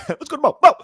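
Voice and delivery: high-pitched copy of a hyped host; echoed host impression.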